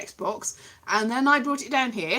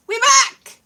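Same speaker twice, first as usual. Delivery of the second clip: cheery return; 11 semitones higher, 10 dB louder than usual. Real, not acted.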